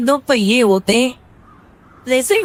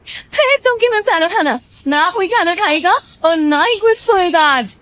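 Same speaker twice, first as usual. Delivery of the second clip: much higher angry outburst; a wife's shrieking tantrum, pitched up; yelling wife; 8 semitones higher, 3 dB louder than usual. Acted. Reena yells at Gaurav about dinner, high and angry. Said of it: "वो जोर जोर से चिल्लाने लगती है".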